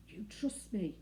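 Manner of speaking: plain reading voice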